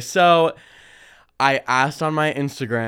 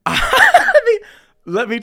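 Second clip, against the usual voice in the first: laughing; 8 dB louder than usual. Real, not acted. Laughing at dog peeing story.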